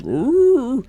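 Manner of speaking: high, loud rising "roo"; comic high-pitched animal whoop; imitated bear cry. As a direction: howl out a startled bear's cry. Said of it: "and the bear goes".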